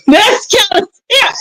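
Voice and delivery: high, laughing repeat. Real, not acted. Laughing repeat of her line.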